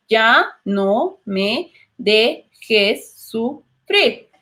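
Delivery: very slow, "Ya no me dejes sufrir" split into separate syllables. Shown as a demonstration. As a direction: spell out each syllable with a pause. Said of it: "lo separamos en sílabas". (muy lento, sílaba por sílaba)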